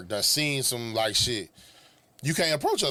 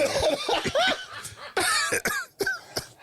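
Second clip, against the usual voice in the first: long, very high-pitched laugh; high laughing fit; hard laugh. Real, not acted. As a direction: crack up helplessly for several seconds. Cracking up at the outfit.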